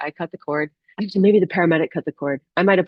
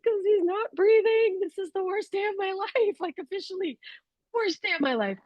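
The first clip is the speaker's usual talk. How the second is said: high, strained retelling of panic; high frantic voice fading to a tight hush; retold panic; 12 semitones higher, 4 dB quieter than usual. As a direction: relive the terror, pitched up and breaking to a hush. Retold panic during birth.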